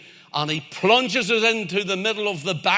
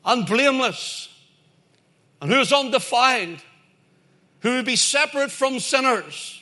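high, driving sermon delivery; emphatic pulpit proclamation, pitched up; forceful preaching; 6 semitones higher, 4 dB louder than usual. Real, not acted. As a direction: preach it out with rising conviction.

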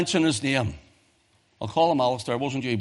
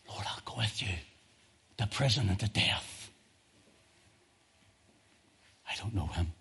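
reverent hush; 7 semitones lower, 9 dB quieter than usual. Acted. Quiet, reverent scripture meditation.

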